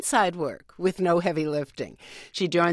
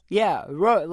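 ordinary talk

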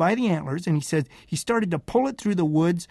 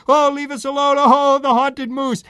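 high, loud mock-panicked wail; terrified superstitious loggers; 6 semitones higher, 7 dB louder than usual. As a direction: wail out a hammed-up fright. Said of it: "are very superstitious".

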